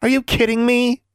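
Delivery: very high, incredulous outburst; near shout. A narrator's reading. Roommate cannot believe she wants bed, near shouting. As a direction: exclaim it high in disbelief. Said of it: "Anna practically shouted".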